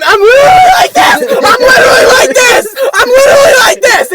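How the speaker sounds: very high, loud half-crying yell; retelling the x-ray scan, shouting high and laughing; laughing wailing shout. Retold or imitated. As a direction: wail it in mock despair. Frantic airport retelling.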